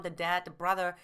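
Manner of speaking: ordinary talk, nothing marked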